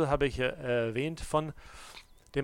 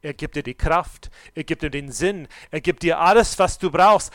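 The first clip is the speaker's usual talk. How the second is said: louder, rising proclamation; preaching at full force; 4 semitones higher, 12 dB louder than usual. Acted. Preacher promising strength from Jesus, building louder. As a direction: build it up with each phrase.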